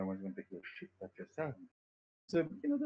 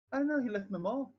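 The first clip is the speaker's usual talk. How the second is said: put-on the girl's punchline in a much higher, louder Irish voice; Irish accent; 6 dB louder than usual. Streamer voicing the girl in the Irish meme, high Irish accent. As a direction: squeak the answer out in Irish, all innocence. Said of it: "I mean, like, real Irish".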